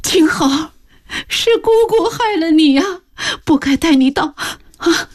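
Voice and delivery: high, tearful keening; sobbing lament. Acted. Aunt weeps over the dead boy, sobbing lament. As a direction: weep over him, blaming herself. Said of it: "失声痛哭道".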